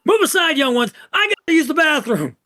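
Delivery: high, loud, urgent student; pushy shout. Acted. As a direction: barge through, calling over everyone. Junior shoves others aside.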